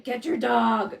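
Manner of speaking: loud mock shout at a roommate; a quick loud yell over the dog and cat; retold yell. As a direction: call out sharply to grab the dog. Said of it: "and I'd be like".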